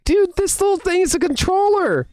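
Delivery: high-pitched wonder at a discovery; amazed exclamation. Retold or imitated. Re-enacts amazement at controller.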